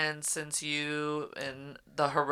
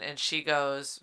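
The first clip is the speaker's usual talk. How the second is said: normal talking voice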